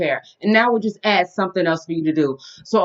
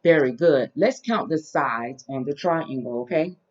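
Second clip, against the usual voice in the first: put-on reading to a toddler; 3 semitones lower, 6 dB quieter, slower than usual. Teaching toddler shapes.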